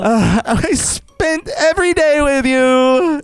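high, dragged-out whimpering wail; exaggerated tearful whine; mock emotional. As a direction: lament it like a jilted lover, hammed up. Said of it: "I start getting a little emotional".

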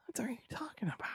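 breathy whispered aside. Retold or imitated. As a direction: whisper it under your breath. Whispered retort, unvoiced.

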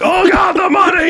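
very high, loud ecstatic shout; mock yelling. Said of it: "and yelling".

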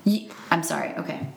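normal talking voice

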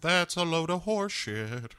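mock Southern singer's speaking voice. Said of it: "And [name] is like".

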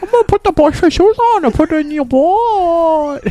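high squeaky goofy voice; silly voice. Pretend. Friend joins in with a high goofy voice. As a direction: squeak it goofily.

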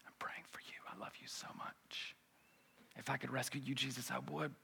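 soft loving whisper; tender whisper. Pretend. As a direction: breathe it gently like a consoling friend. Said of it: "there wasn't a smile like".

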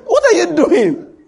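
high, loud, incredulous wife scolding; a laughing wife's high outraged why; imitating shocked wife. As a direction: squeal it like a stunned wife catching you at the bin. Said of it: "My wife asked me whether I was all right".

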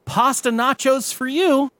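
high, put-on waitress line; chirpy server reading a menu item. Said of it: "Waitress, quote unquote".

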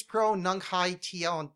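"pronunciation" broken into separate syllables; carefully announced "pronunciation", part by part; sounded-out pronunciation. Shown as a demonstration. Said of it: "is how you pronounce it".

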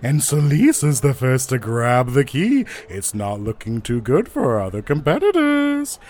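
loud play-by-play showman; loudspeaker announcer. Acted. Announcer reports Celeste grabbing the key, loud showman voice. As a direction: call it to the whole arena, stretching the last word. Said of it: "you hear over, um, the loudspeaker again".

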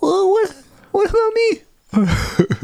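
high, very slow hurt little voice; fairy left out, drawn-out pitiful whine; whiny Tooth Fairy. Pretend. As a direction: sulk as the forgotten Tooth Fairy.

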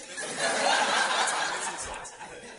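long breathy laugh; an amused unvoiced chuckle. Real, not acted. Laughs after teasing apostle.

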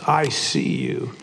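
a creepy disembodied voice in the dark; parrot voice. Acted. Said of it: "And the parrot says".

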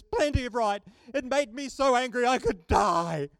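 a hint of a petulant angry Jonah; mildly huffy, sulky retort. Jonah answering God over the shade tree, mildly huffy. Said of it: "really angry".

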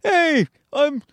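high, put-on plugging voice; mock self-promoter. Comic plugging shows on MySpace, high mock hype.

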